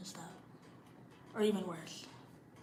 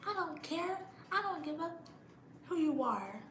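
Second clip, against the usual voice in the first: defiant; 7 semitones higher than usual. Real, not acted. Defiant retort to parents.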